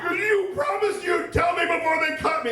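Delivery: high, loud outraged screech; screamed accusation. Retold or imitated. Wife accusing husband after surgery starts, screaming. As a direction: screech it as an imitated furious wife.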